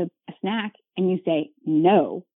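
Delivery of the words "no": a curt, flat refusal; stern no. Shown as a demonstration. Parent refusing a child's snack request, curtly. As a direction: shut the request down in one word. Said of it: "like that".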